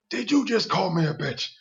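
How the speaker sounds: Robert jumping up, offended; indignant, rising. Retold or imitated. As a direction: bristle at the insult, pitch up. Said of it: "He jumped up".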